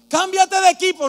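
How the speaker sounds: high, loud quoted jeer; mock shout. Preacher retelling the jeer at him, loud. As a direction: yell the insult back at him. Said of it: "me hayan gritado".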